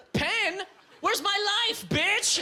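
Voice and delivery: hammed-up furious reply; mock outburst. Acted fight over a pen.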